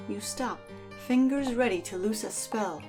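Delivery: usual speaking voice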